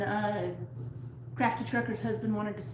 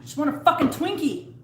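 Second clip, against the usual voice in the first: raised, higher movie-quote yell; mock outburst; 5 semitones higher, 4 dB louder than usual. Quoting a zombie-film Twinkie hunter to a co-host, mock-exasperated outburst. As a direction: play up a desperate craving.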